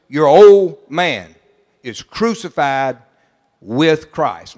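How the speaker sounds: each word weighed, slowed way down; slow solemn reading. Acted. Preacher reading the key verse to the church, slow and weighty. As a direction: lay the verse down heavily and slowly.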